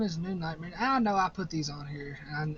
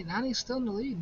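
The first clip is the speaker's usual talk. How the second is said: ordinary delivery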